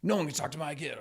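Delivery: high, put-on voice of an indignant parent; mock protective parent. Parents online insisting nobody talks to their kid, in a lightly mocking high voice. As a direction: play the overprotective parent, hammed-up. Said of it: "They're like".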